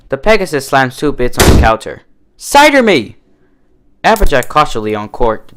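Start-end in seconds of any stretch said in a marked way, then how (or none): 2.3-3.2 high, loud, cocky order; eager demand. A narrator's reading. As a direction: slap the coins down and demand it. Slams bits on counter.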